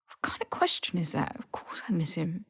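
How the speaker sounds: low, breathy half-whisper, held back; clamping down on feeling, barely voiced; muted whisper of a friend caught off guard; tight, hushed reply. A narrator's reading. Ron, jaw tight, deflects.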